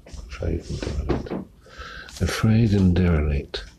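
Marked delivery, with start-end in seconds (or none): 0.1-1.3 quick whispered first take. Shown as a demonstration. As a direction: breathe the words fast and soft. Whispered take before spoken one.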